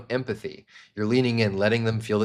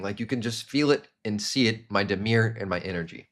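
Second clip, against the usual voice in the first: usual speaking voice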